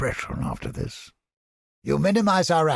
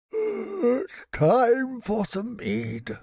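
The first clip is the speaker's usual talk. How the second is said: drawn out, much higher and slow; slow and savoring; 10 semitones higher, much slower than usual. Acted. Mercenary savoring the thought of mead after battle. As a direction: stretch every word, relishing it. Character barks in a game.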